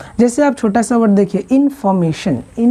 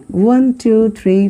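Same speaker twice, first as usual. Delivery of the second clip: ordinary talk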